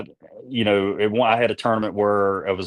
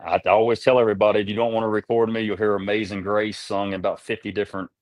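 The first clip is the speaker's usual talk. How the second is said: usual speaking voice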